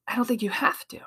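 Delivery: hushed, breathy answer to the doubter; whispered reply. Acted. To a doubting listener, a soft whispered reassurance. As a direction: lean in and whisper it like a secret.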